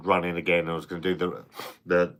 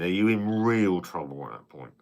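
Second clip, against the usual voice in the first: normal talking voice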